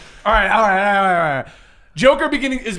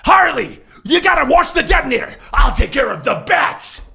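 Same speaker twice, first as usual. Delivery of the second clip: raised, commanding villain voice; higher, hammed-up villain command; Joker; 4 semitones higher than usual. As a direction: bark orders as a cartoon villain. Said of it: "I'm trying to sort it out in my head".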